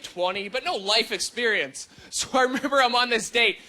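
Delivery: normal talking voice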